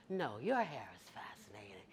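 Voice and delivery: softly whispered echo; whispered reply. Retold or imitated. Man whispering the compliment back to her, hushed.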